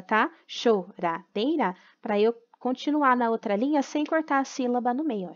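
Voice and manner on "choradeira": Choradeira said slowly as cho-ra-dei-ra, each syllable apart; syllables split. Shown as a demonstration. Teacher showing pupils how to split a word, syllable by syllable. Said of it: "separação de sílaba".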